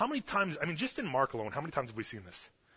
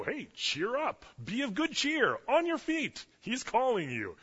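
high, singsong cheering-on; swooping, high-pitched crowd encouragement; mock encouragement; 9 semitones higher, slower than usual. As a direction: coax someone up with exaggerated pep. Crowd now cheering the beggar on.

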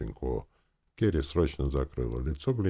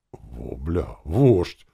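startled, with a louder jump on the last word; surprised; 7 dB louder, much slower than usual. A narrator's reading. Uncle reacting to hearing of a clan chief, surprised. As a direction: blurt it out in surprise. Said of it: "удивился дядя Фома".

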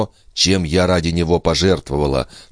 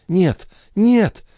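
very high, strained little voice; faint broken plea. A narrator's reading. Weakened Andrea refusing her maid, faint and high. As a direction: plead it weakly, far up in pitch. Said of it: "еле внятным прерывающимся голосом прошептала Андреа".

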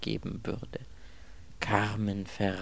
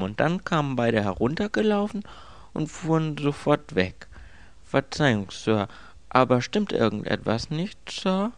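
higher-pitched, eager manservant; obliging servant's voice, pitched up; deferential servant; 8 semitones higher, 5 dB louder than usual. Acted. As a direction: report dutifully, ending in polite concern.

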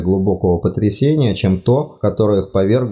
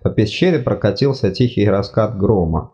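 ordinary delivery, nothing marked

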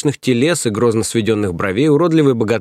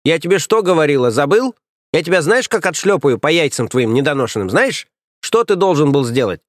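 berating nanny; 5 semitones higher than usual. Acted. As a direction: scold him like a furious nanny.

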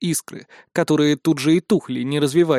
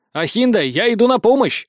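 raised, higher call across the battle; 6 semitones higher than usual. A narrator's reading. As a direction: shout a warning that help is coming. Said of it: "предупредил я". (громкий оклик, выше обычного)

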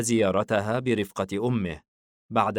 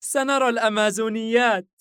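put-on high, loud child's cheer; small boy bubbling with eagerness; excited little boy; 13 semitones higher, 7 dB louder than usual. A narrator's reading. Son running to father's hands, excited child voice. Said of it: "حايم ضاحكا لحماسه".

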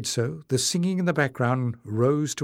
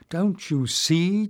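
higher, slow and emphatic; exclaiming; 6 semitones higher, slower than usual. A narrator's reading. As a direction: press it home urgently, slowly. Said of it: "Jasmine exclaimed".

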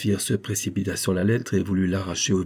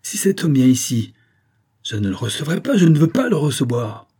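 raised, vehement refusal; angry exclamation; 5 semitones higher, 5 dB louder than usual. A narrator's reading. Vicomte refuses his rival entry, exclaiming angrily. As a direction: lay down the law with temper. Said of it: "Son caractère violent reprit le dessus".

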